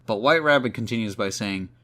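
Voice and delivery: ordinary delivery, nothing marked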